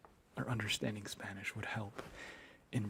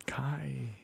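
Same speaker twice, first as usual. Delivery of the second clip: ordinary talk, nothing marked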